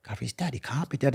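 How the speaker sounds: high, hushed shock; stunned disbelief. Retold or imitated. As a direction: say it small and high, not believing it. Retells his shocked disbelief to inmate.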